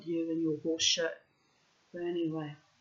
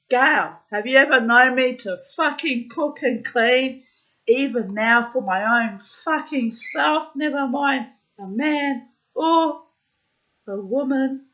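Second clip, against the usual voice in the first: loud, high acted-out outrage; exasperated telling-off at raised pitch; indignant protest; 8 semitones higher, 14 dB louder than usual. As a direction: protest loudly, stretching the later words. Acted protest to friend's plan.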